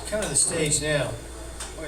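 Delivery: ordinary talk, nothing marked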